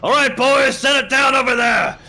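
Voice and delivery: bellowed order. Acted. Captain shouts orders to his Dragonborn crew, loud bellow. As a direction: call out across the kitchen like a captain.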